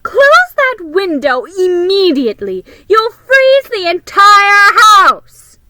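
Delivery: full-name scolding like a stern big sister; bossiest voice. Acted. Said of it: "she said with her bossiest voice".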